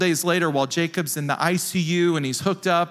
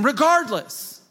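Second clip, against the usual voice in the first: rising, more fervent preaching voice; 5 semitones higher than usual. Acted. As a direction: preach it with growing fire. Preaches Psalm and praise passionately.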